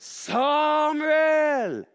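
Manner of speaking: loud, high, long-held call; Gud calling out. A narrator's reading. Storyteller as the voice waking Samuel, loud drawn-out call. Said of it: "Gud som ropte på Samuel".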